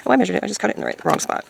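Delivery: normal talking voice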